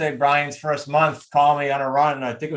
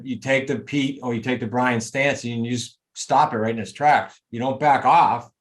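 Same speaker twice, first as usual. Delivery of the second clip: ordinary talk, nothing marked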